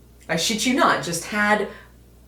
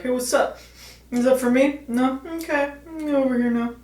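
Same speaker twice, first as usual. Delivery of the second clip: high, playful voice of a bulldog sniffing around; dog voice; 7 semitones higher than usual. Pretend. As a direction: voice the dog begging at the table, pitched up. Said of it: "I don't know why he has that voice".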